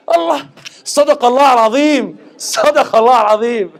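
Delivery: high, loud, laughing awe; awed exclamation. Retold or imitated. Shopkeeper marvelling at a foreigner's Arabic. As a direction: exclaim it like hearing holy recitation.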